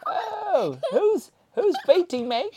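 high, loud, wailing toy voice; crying toy voice. Pretend. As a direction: wail as if being hit. Toy cries out being hit.

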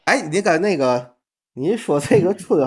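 ordinary delivery

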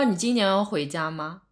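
normal talking voice

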